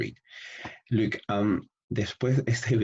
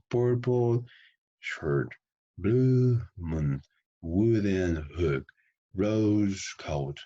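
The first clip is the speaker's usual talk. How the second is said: slow stretched vowels, pause after each pair; open-mouthed practice demo; 3 semitones higher, much slower than usual. Shown as a demonstration. Teacher models colour phrases, open-mouthed and drawn out. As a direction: open wide and draw each word out. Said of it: "you have to open your mouth".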